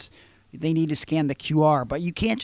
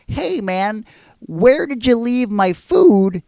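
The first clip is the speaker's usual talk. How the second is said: higher, louder, slow pointed question; retold angry demand; 5 semitones higher, 6 dB louder, slower than usual. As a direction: lean on him, barely holding your temper. Calling back, now pissed.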